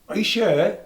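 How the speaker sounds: a mobster double-checking the hit, in mock surprise. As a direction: doubt the choice out loud. Mobsters doubting the pick.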